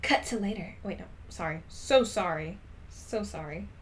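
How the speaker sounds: usual speaking voice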